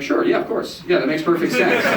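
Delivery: sarcastic. Acted. Sarcastic agreeing.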